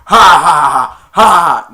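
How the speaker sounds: drawn-out, very loud attack cries; loud battle "ha". Retold or imitated. Man smacking four Santas, loud mock battle cries. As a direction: belt out each blow cry. Imitated yell while smacking.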